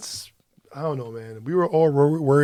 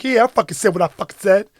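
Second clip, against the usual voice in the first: defiant bragging; 6 semitones higher, 6 dB louder, faster than usual. Retold or imitated. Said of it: "She's like".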